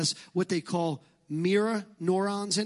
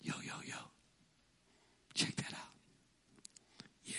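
whispering; 18 dB quieter, faster than usual. Pretend. Listener grooving to the music.

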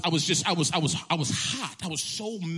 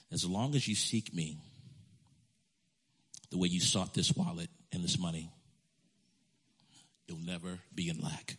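low and softer, fading away; solemn whisper-soft promise; 9 semitones lower, 5 dB quieter than usual. Retold or imitated. Holy Spirit promising him never to lack, soft and low. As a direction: speak the promise gently, almost hushed.

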